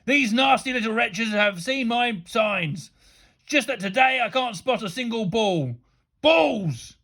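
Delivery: furious teacher ranting; thundering rant. A narrator's reading. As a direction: rage about the pupils, voice pushed up. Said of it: "he thundered".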